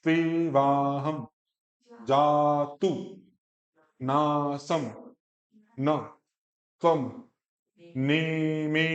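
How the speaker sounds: तेवाहम slow and even with a falling end; slowly. Shown as a demonstration. Teacher modelling तेवाहम at a slow pace. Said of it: "धीरे धीरे करके बोलो".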